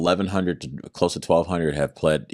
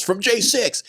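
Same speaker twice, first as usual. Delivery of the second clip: mock agent; 3 dB louder, slower than usual. Agent names charge at arrest.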